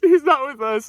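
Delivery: high, laughing repeat of the denial; laughing heckle. Retold or imitated. Sober living guy in the back, laughing as he disowns him. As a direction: crack up while disowning him. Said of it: "in the back like".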